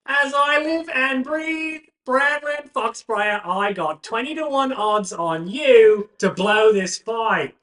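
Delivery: high, loud, slow brash bookie greeting; booming friend voice. Acted. Friend Paxton hollers a greeting to returning fighter, high and loud. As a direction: bellow it like an old gambling buddy.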